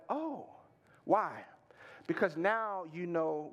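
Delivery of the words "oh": soft, high oh that falls away; dawning understanding. Retold or imitated. Patient finally grasps the diagnosis, a soft oh of realization. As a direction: let the realization swoop up gently. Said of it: "And then the third "oh" you have is like".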